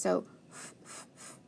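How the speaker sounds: teacher sounding out forget; whispered sound-out. Shown as a demonstration. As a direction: hiss the f sounds. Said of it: "Let's do forget first".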